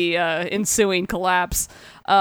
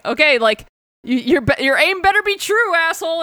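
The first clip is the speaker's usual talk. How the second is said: higher-pitched mock warning; retold warning; 8 semitones higher than usual. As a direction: warn them in a rising, lightly acted voice. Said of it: "he's just like".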